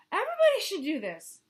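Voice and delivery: high, loud proud declaration; Hippo boast. Acted. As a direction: proclaim it.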